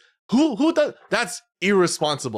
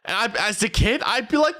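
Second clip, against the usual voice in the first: ordinary talk, nothing marked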